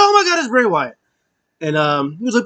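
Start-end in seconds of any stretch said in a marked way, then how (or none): 0.0-1.0 high, loud retold amazement; hammed-up high exclamation; excited reaction. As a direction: act out a startled fan spotting someone. Second, excited take of reaction.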